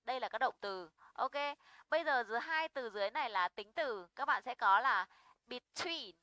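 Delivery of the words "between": between with the weight on TWEEN; light be, stressed long TWEEN in between; stress on second syllable. Shown as a demonstration. Teacher models a preposition, between stressed on TWEEN. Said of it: "trọng âm phải rơi vào tween".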